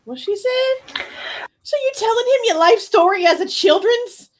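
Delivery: high-pitched, comic incredulity; mock disbelief. Commentator reacting to her childhood story, in mock disbelief. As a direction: pitch it way up, playing baffled and appalled.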